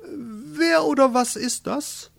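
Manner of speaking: very high, slow haughty question; condescending. A narrator's reading. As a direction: look down your nose, drawing it out high. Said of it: "betrachtete ihn von oben herab". (herablassend, hoch und gedehnt)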